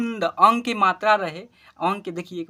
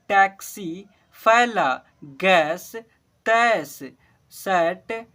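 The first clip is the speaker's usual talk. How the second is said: each word called out louder and clear, one by one; loud word-list reading; 5 dB louder, much slower than usual. Shown as a demonstration. Teacher calling out Hindi words to pupils, loud and clear. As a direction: read every word out loud for the class to repeat.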